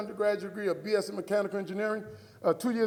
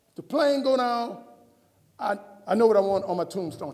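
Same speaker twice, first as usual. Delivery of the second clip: loud insistence; 10 dB louder than usual. Retold or imitated. Husband giving wife his second instruction, loud and insistent.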